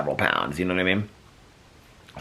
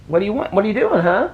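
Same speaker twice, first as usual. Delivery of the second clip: pet talk; 13 semitones higher, 4 dB louder than usual. Real, not acted. Owner cooing high at her dog on camera. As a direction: coo up high at the pup.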